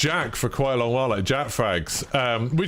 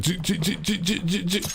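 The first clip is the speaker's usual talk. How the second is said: rapid, higher-pitched "j-j-j" sounds; imitated repeated noise; 6 semitones higher than usual. Streamer mimicking constant noise in the play being watched, imitated repeated noise. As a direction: stutter out a repeating mechanical noise.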